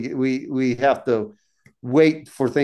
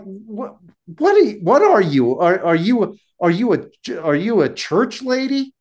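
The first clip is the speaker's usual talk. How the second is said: higher and louder, mocking disbelief; exasperated mockery; 6 semitones higher, 4 dB louder than usual. Magician mocking the other as a church lady, exasperated. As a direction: needle him, incredulous and fed up. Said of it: "just mad and exasperated".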